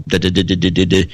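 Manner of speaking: stammering da da da imitation; a hint of a halting speaker; mock stammer. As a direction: stumble through nonsense syllables. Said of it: "close to being stuttering".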